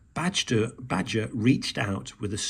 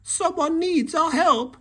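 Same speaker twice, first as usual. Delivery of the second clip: much higher, louder birdlike chirp; 5 dB louder than usual. A narrator's reading. Bird calling the friends to help, high chirp. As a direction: keep chirping like a bird in a hurry. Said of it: "she chirped".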